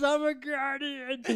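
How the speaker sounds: high drawn-out mock voice; mimicked slurred heckler. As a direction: imitate a dim-witted fan, drawn out. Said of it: "he goes, he goes".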